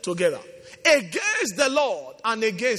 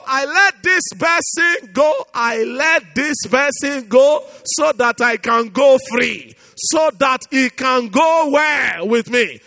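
preaching at full force; 3 semitones higher, 7 dB louder than usual. Real, not acted. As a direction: pour it out over the congregation.

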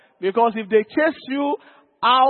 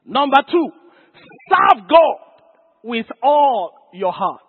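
loud, raised pulpit proclamation; shouted preaching point; 5 dB louder, slower than usual. Real, not acted. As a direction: announce it, then shout the command. Preacher proclaims his next point.

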